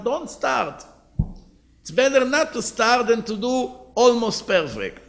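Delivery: insist, lecturing, don't start at all; firm emphatic warning. Retold or imitated.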